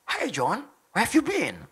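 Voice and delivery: put-on English accent with swooping tune; playful English-accent impression. Shown as a demonstration. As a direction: greet a friend like an English native. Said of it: "kayak orang Inggris".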